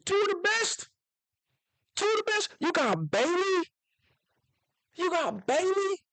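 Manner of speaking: high, excited, repeating the names; hyped up, shouting the praise; high-pitched thrilled fan; excited hype. Real, not acted. Hyped about the Jets' picks.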